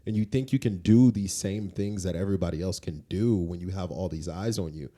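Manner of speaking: ordinary talk, nothing marked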